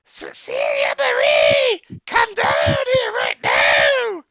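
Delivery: drawn-out, full-force parental yell; loud masculine shout. Acted. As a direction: bellow upstairs for the child, slowly. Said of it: "A loud masculine voice".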